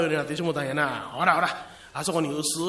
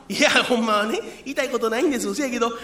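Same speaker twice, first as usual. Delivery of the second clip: normal talking voice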